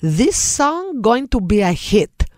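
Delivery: put-on slow, accented Greek mom; Greek mother's accent. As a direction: imitate a Greek mother's English. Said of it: "She had an accent".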